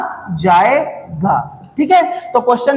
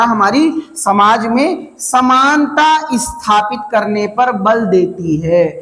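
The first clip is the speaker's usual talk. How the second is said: slow, pausing phrase by phrase for note-taking; slow dictation; slower than usual. Shown as a demonstration. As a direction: dictate it slowly so students can write. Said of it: "repeats the definition as dictation". (धीमे-धीमे लिखवाना)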